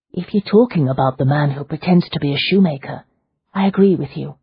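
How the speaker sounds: usual speaking voice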